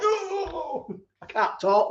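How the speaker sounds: put-on a light drunken whine, high on the oh; mock drunk. Streamer mocking his drunk self, a light tipsy whine. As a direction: lightly play a drunk who can't get words out. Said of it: "I'm just like".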